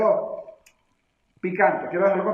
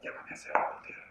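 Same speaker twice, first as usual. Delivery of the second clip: whisper, no voice at all; whispered order; 14 dB quieter, faster than usual. Acted. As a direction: breathe it to the messenger like a secret. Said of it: "le dice". (susurro grave)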